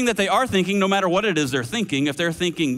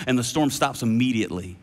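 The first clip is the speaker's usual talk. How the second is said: ordinary talk, nothing marked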